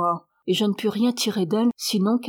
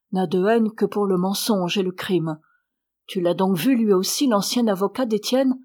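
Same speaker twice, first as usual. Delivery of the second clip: ordinary delivery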